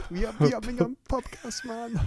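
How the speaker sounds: high pitched, laughing through the slogan; real laughter. Real, not acted. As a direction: giggle the name out.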